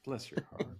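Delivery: soft put-on Georgian accent; Southern accent. Co-host echoing the Southern pleasantry, softly accented. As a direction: say it quietly with a Southern lilt. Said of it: "do it in a Southern accent".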